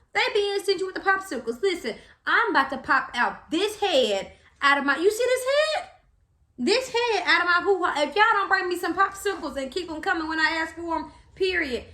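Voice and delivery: high, feisty mock threat; sassy demand. Labouring mother demanding popsicles, sassy threat.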